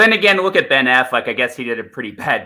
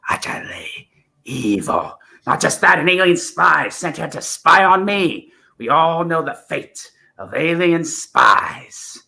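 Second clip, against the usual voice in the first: higher, menacing, sinking to a whisper; accusing Doctor; 7 semitones higher than usual. Acted. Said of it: "let me try the fifth and the sixth".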